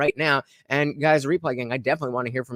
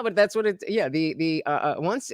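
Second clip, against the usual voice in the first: ordinary talk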